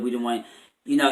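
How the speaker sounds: usual speaking voice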